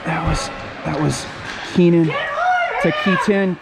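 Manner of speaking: can't contain the excitement; a dad commentating his son's game, getting carried away; worked up. Real, not acted. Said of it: "I'm getting a little worked up here".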